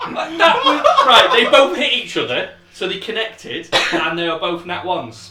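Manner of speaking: laughing through the words; wheezing with laughter at the roll. Real, not acted. Laughing at double nat one.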